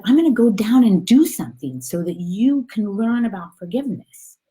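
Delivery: high, emphatic storybook voice, easing at the end; helpful little soul. Acted. Other soul explains its plan, high and earnest. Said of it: "he said".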